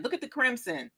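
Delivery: ordinary talk, nothing marked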